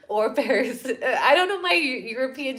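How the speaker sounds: laughing at herself mid-sentence. Real, not acted. Vlogger admits not knowing geography, laughing. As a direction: giggle through the confession.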